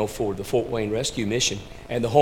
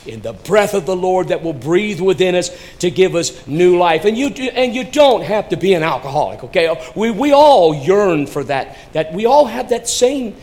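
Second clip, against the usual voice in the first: high, loud climactic appeal; swelling, forceful pulpit cry; impassioned preaching; 7 semitones higher, 9 dB louder than usual. Real, not acted. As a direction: press the need on every listener.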